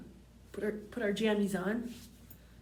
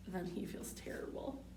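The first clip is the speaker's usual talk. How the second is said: soft hushed whisper; 4 semitones lower, 13 dB quieter than usual. A narrator's reading. Narrator whispers Shrek's regret to the children, hushed. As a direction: breathe it out as a secret.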